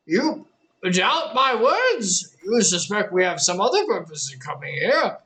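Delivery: put-on menacing old elder. Elder turns suspicious and menacing.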